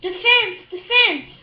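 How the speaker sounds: high, loud sideline yell. Acted. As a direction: shout from the sideline like a dad. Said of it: "Froggy's dad was yelling".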